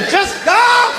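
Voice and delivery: high, strained yell; acted outburst of frustration; exasperated shout. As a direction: scream at a stubborn animal to move.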